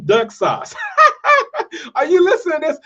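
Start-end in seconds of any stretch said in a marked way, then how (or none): 0.8-1.9 sudden high-pitched laugh of disbelief; incredulous squealing laugh; high burst of laughter. Real, not acted. Outraged by one duck sauce. 2.0-2.8 laughing in disbelief. Real, not acted. Disbelief at the duck sauce.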